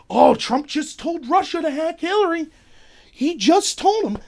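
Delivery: high-pitched, scandalized imitation of critics; mock outrage. Critics reacting to Trump's joke about Russia, mock outrage. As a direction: gasp it out as mock alarm, stretching the end.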